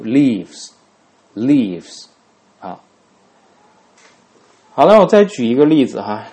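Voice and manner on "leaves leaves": leaves stretched out slowly; careful slow "leaves"; teacher modelling "leaves" twice; model pronunciation. Shown as a demonstration. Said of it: "读".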